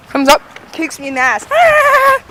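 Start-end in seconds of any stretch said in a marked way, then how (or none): 1.5-2.2 put-on very high childish squeal; high mocking kid cry; taunting kid noise. Cousin kicks him in timeout, high taunting squeal.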